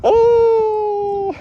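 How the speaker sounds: high loud cry; shout. Real, not acted. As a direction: cry out in dismay. Shouts in dismay.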